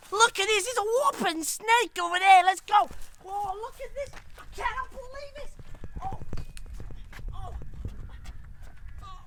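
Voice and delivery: high, amazed shout in put-on Australian; excited Australian outburst. Explorer spotting a whopping snake, high excited Australian shout. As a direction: cry out like an astonished wildlife explorer.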